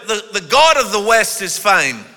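ordinary delivery

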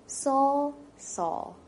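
a name called, then whispered; hushed call. Acted. Jesus calling to Saul on the road, whispered. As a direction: let the question fall to a whisper.

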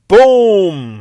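long, loud, drawn-out boom; sudden explosive storyteller's bang; booming exclamation. Retold or imitated. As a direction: blast out one big slow word. Sudden dramatic entrance in retold story.

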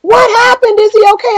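worried teachers. Retold or imitated. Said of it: "teachers came running".

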